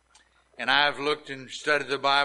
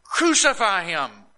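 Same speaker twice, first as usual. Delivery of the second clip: louder mock crowd demand; crowd crying out; 4 semitones higher, 4 dB louder than usual. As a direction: call it out like a mob. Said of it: "they cried out, saying".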